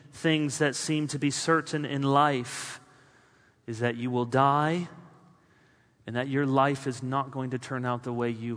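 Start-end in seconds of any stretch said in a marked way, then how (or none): none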